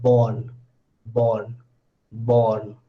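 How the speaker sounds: "born" low and slow, long aw, no r; lengthened vowel. Shown as a demonstration. Teacher showing lengthening again, drawn-out low "born". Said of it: "this is again lengthening".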